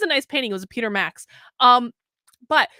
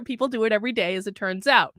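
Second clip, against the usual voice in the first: ordinary talk, nothing marked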